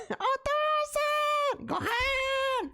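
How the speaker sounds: put-on high, stretched anime kid call; Gohan voice. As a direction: shout for dad like a cartoon kid. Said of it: "That's why I started doing the Gohan".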